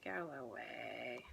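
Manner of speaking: a quiet, drawn-out plea to a rising feeling. Real, not acted. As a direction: gently shoo it away, slowing. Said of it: "this whole thing's coming up".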